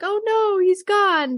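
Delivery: high, loud mock alarm; dramatic fan's lament; mock dismay. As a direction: cry out in pretend dismay. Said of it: "at first we're like".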